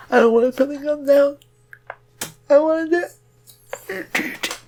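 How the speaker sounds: put-on whiny childish voice. Brian refuses like a stubborn child.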